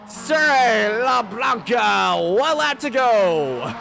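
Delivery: drawn-out mock announcer shout; quoting Marco Balbonera. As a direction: stretch it like a hyped announcer. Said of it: "to quote Marco Balbonera".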